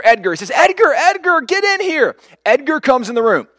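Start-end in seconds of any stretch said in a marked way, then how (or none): 0.5-2.1 high, urgent mock yell for a brother; retold yelling. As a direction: call out to the next room, pitched high. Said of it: "he starts yelling for his older brother".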